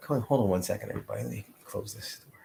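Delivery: ordinary delivery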